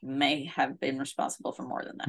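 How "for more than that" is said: whispering. Real, not acted. Ominous aside, unvoiced.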